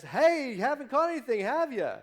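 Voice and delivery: high, loud call from the shore; yelling out. Retold or imitated. Stranger on shore yells to the boat, high and loud. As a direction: shout it across the water, high and loud. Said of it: "yells out to them and says".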